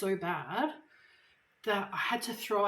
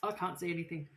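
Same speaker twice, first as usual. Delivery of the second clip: ordinary talk, nothing marked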